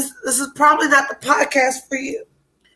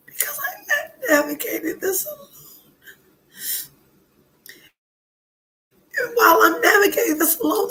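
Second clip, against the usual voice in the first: tearful and breaking, with laughs through the sobs; real crying; 4 semitones higher than usual. Real, not acted. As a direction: let the voice crack and cry as it rises. Crying while retelling the date.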